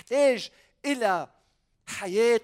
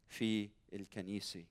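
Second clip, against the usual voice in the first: ordinary delivery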